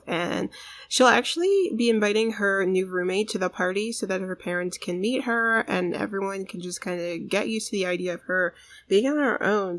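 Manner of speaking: ordinary talk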